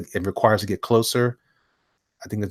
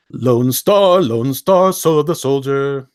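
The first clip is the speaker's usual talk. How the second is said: loud, raised-pitch showman call; hyped title announcement; 4 semitones higher, 8 dB louder, slower than usual. Pretend. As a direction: proclaim the title with gusto. Announces next title theatrically.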